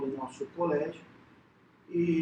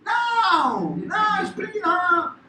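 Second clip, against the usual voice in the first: high, loud, slow Italian refusal; angry Italian waiter; 12 semitones higher, 9 dB louder, much slower than usual. Retold or imitated. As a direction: bark it out like an irritated waiter. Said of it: "aí eles ficam com raiva".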